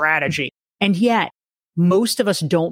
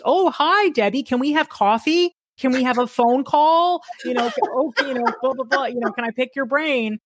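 high, chirpy pleading voice; mock eager requester; 7 semitones higher than usual. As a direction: imitate an eager favor-seeker. Mimicking people asking for time.

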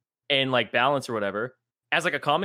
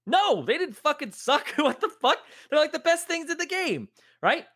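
indignant outburst; 11 semitones higher than usual. Real, not acted. Indignant rant, laughing.

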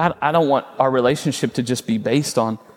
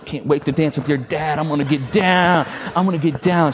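playful dad, a bit higher; teasing dad; 3 semitones higher than usual. Retold or imitated. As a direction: tease your daughter, hamming it up lightly. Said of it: "I was messing with her".

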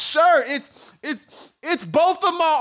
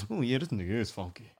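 quick, much lower and softer aside; mock onlooker; 14 semitones lower, 13 dB quieter, much faster than usual. Imitating people confirming his smell under their breath. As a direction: mutter it like a nosy sniffer.

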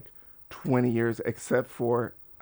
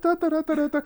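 high, nonsense babble; imitated phone fiddling. Cop busy on his cell phone, high imitated babble. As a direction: mimic a preoccupied cop with da-da syllables.

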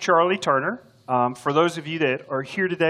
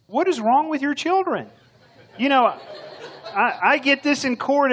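exasperated; 8 semitones higher than usual. Acted. Exasperated at kids' fighting in skit.

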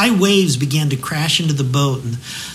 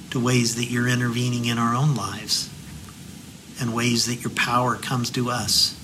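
prayer; 3 semitones lower, 6 dB quieter than usual. Real, not acted. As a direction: pray quietly and sincerely, fading to amen. Closing prayer.

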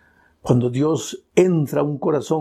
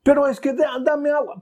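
pleading thirsty man; 11 semitones higher than usual. Acted.

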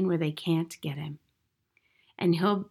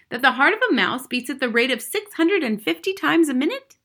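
put-on higher, louder, eager child's question; sudden; 6 semitones higher, 5 dB louder than usual. A narrator's reading. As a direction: blurt out a fascinating fact. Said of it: "Matilda said suddenly".

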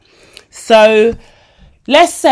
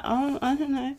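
a much softer, sheepish murmur; embarrassed murmur; 7 semitones higher, 13 dB quieter than usual. Retold or imitated. Embarrassed girl answering her friend, murmuring softly. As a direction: duck the question, barely audible.

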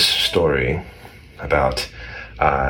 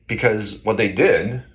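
ordinary talk

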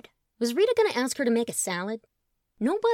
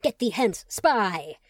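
loud, hammed-up villain intoning; intoned villain; 6 dB louder, much slower than usual. Colin mock-intoning at Truman like a villain, loud. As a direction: intone it like a cape-swirling villain. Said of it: "throwing a pretend cape".

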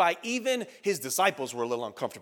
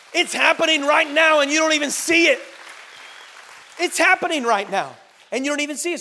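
loud, raised-pitch warning; urgent preaching shout; 5 semitones higher, 8 dB louder than usual. Real, not acted. Preacher warning congregation of unseen lies, shouting urgently. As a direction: ring it out forcefully to wake them up.

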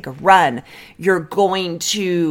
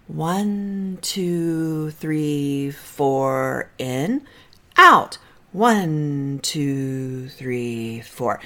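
soft, slow counting, falling away to near silence; slow counted breaths; 4 semitones lower, 5 dB quieter, much slower than usual. Shown as a demonstration. Therapist counting a client's breathing down, soft and slow. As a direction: count the breath out softly and slowly. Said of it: "get it to the point where it's one, two".

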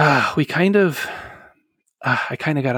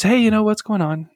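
higher-pitched, lightly imitated mom; casual greeting; 8 semitones higher than usual. Mom answering her child's video call, casual greeting. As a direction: imitate a mom's breezy hello.

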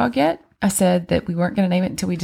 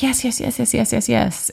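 higher, rapid eager yeses; imitating excited girls; 7 semitones higher than usual. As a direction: beg like the kids did. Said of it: "the girls were like".

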